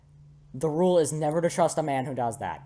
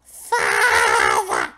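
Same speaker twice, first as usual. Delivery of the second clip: harsh, drawn-out loud yell; hammed-up distorted roar; acted scream; 13 semitones lower, 9 dB louder than usual. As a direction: scream it long and rough. Voicing character screaming for father.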